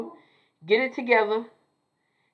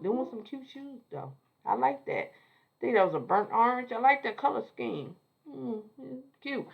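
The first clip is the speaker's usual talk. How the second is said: soft admiring aside; 2 semitones higher, 7 dB quieter than usual. Real, not acted.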